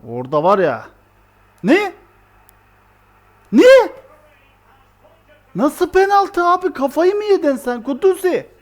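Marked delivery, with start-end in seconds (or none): 1.6-3.9 sharp high shout of disbelief; shocked disbelief. Real, not acted. Goal against, shocked disbelief. 5.6-8.5 higher, loud outraged complaint; angry protest. Real, not acted. Protesting a penalty call.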